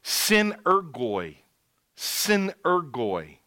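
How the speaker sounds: slow, careful Synergoi, each syllable clear; Greek word said slowly. Shown as a demonstration. Preacher pronouncing Greek Synergoi slowly for congregation. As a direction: sound out Synergoi slowly so they hear the English root. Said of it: "I'll say it in Greek ... Listen to it".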